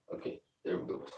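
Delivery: unvoiced whisper, very soft. Real, not acted. Whispering to himself while setting the train down. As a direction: breathe it out under your breath.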